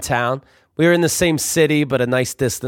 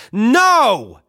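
dramatic no; 9 semitones higher, 4 dB louder than usual. Retold or imitated. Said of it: "he tries the drama one more time".

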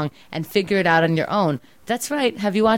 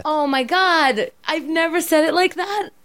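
exasperated disbelief; 9 semitones higher, 5 dB louder than usual. Real, not acted. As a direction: stretch out Oh my God in disbelief.

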